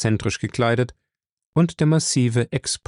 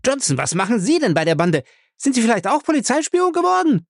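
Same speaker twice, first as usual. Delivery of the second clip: furious; 12 semitones higher than usual. Acted. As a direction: scream the accusation at him. Raging Ray accuses Johnson. (wütend schreiend)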